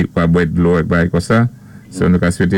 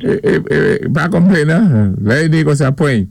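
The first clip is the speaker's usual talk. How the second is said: excited greeting; 6 semitones higher than usual. Retold or imitated. Mimics a friend greeting Charles.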